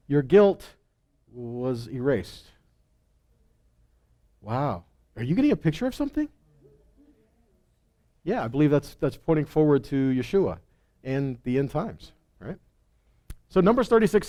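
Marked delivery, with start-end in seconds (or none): none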